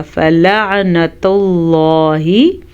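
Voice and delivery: फलअनतुल्लाहि slow, अल्लाह heavy and full; recited with full Allah. Shown as a demonstration. Teacher showing students the full Allah. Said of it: "हमने लफ्ज़ अल्लाह को पुर पढ़ा".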